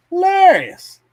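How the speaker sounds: shrieked high and loud, put-on voice; mocking falsetto cry; mock high squeal. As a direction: squeal it like a cartoon. Repeats in same mock voice.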